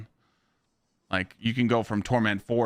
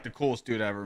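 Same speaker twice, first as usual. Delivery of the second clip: ordinary talk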